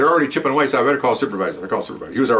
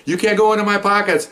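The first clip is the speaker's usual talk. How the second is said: much higher, mock indignant protest; imitating protesting woman; 10 semitones higher, 4 dB louder than usual. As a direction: squeal her protest up high.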